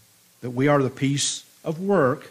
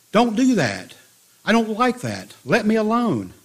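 raised, higher-pitched yell of protest; 7 semitones higher, 4 dB louder than usual. A narrator's reading. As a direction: cry out in protest, pitched up and louder. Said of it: "And I yelled out".

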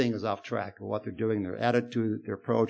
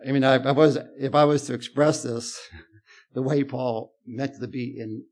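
ordinary talk

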